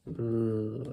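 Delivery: ordinary talk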